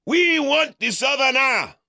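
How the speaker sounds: a crowd baying for him; screaming mob. Acted. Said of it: "they screamed".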